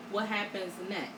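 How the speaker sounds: normal talking voice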